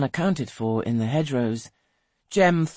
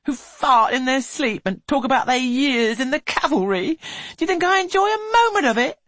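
high, loud wailing complaint; lament; 13 semitones higher, 6 dB louder than usual. A narrator's reading. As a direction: wail it out as a lament. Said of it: "ascending again into a lament".

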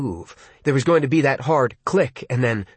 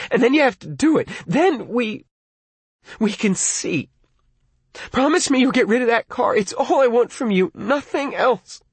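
high, pleading and tearful; near tears; 9 semitones higher than usual. Acted. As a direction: beg through near-sobs. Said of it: "even closer to crying".

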